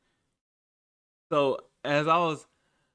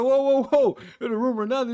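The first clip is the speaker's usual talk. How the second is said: much higher, louder whoas of alarm; alarmed protest; 7 semitones higher, 6 dB louder than usual. Retold or imitated. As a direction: wave it off in panic. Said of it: "I flipped the fuck out".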